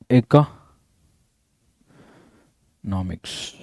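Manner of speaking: Economics dragged out slowly in a low, soft voice for dictation; drawn out. Shown as a demonstration. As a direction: spell out ಎಕನಾಮಿක್ಸ್ syllable by syllable, low and slow.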